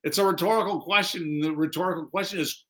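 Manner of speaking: ordinary talk, nothing marked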